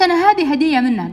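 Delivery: acted indignant objection; heated protest. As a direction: protest loudly, pitch lifted. Girl protests during quarrel.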